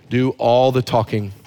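ordinary talk, nothing marked

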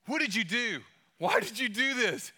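mock-confront the best friend as a hurt third grader. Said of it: "I confront him".

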